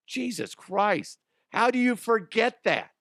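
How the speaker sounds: high, slow incredulous outburst; rising disbelief at the stupidity; exasperated how-could-you. Real, not acted. Outraged at bungee operators.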